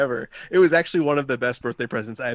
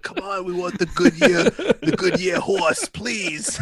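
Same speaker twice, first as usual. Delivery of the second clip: mock-pleading, higher and slow on the horse; pleading Goodyear rep; 5 semitones higher, slower than usual. Joking about sponsors, a begging Goodyear rep pleading. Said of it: "Goodyear tires gonna be like".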